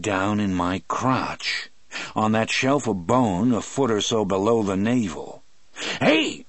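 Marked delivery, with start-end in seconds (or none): 6.0-6.4 high, loud sudden cry; startled cry. A narrator's reading. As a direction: yelp in alarm. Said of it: "I cried".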